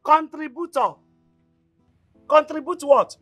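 high, loud, incredulous question; indignant scolding. Acted. Mother challenges daughter who tried to contribute, indignant. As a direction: throw the word back at her sharply.

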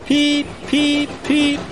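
very high whistled peeps; whistle peeps. A narrator's reading. Thomas hurries in, whistling high to the waiting engines. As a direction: peep like a train whistle. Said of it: "whistled Thomas".

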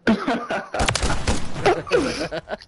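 hiccuping giggle, high and squeaky. Real, not acted. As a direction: laugh broken by a hiccup. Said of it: "hiccuped and laughed all at the same time".

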